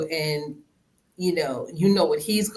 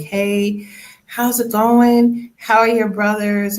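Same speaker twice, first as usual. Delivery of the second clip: lightly voiced aunt, higher and louder; a cheerful aunt asking, a touch higher; 6 semitones higher, 7 dB louder than usual. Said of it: "she'll ask me a question, be like".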